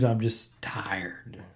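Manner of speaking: slow, breathy whispered sigh of exhaustion; exhausted whisper. Real, not acted. Host tells listeners he is tired of covid, whispering slowly. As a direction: let it drain out as a worn-out whisper. Weary podcaster sighing about covid.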